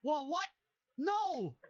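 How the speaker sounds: put-on high squeaky panicked boy; freaked-out character voice. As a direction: squeak out confused protest. AI character protests a timeout.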